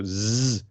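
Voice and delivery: long buzzing z; voiced Z. Shown as a demonstration. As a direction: draw out a vibrating zzz. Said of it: "your voice box vibrates".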